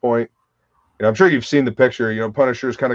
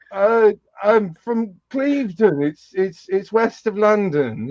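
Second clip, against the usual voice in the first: higher, slow, hesitant fake British accent; shite British accent; 8 semitones higher, slower than usual. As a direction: bluff through in a badly faked British accent. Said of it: "this completely shite British accent".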